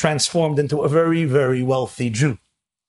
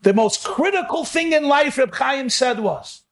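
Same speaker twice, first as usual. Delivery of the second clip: preaching; 10 semitones higher than usual. Acted. Preaching continues high.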